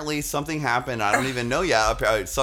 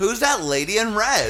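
excited announcer; 6 semitones higher than usual. Acted. Announcing a starlet's entrance.

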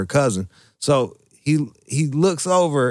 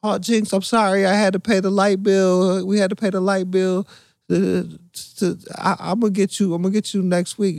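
higher mock girl voice pleading; imitating Bethany; 6 semitones higher than usual. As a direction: plead sweetly in a raised girlish voice. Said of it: "And she was like".

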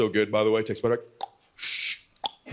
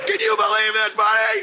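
higher, disbelieving celebration shout; elated yell; 4 semitones higher than usual. Retold or imitated. Dad celebrating with son, elated yell. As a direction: holler it to your boy in triumph.

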